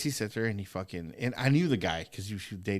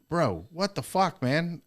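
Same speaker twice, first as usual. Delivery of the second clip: slow, higher, louder disbelief; staring at a bloody foot; shocked, drawn out; 7 semitones higher, 4 dB louder, slower than usual. Retold or imitated. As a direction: stretch out the shocked curse. Retold shock at bloody socks.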